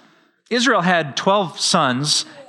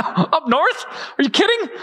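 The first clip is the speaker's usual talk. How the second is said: high-pitched, scoffing disbelief; mock incredulity; 12 semitones higher than usual. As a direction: balk at the very idea, voice shooting up. Mock disbelief of religious leaders.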